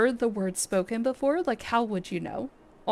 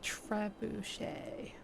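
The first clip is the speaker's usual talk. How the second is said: breathy whispered word, slow; 13 dB quieter, much slower than usual. Real, not acted. Streamer whispering the word while looking it up. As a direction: whisper it to yourself while checking. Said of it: "I'm gonna look it up".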